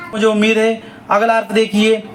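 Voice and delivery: usual speaking voice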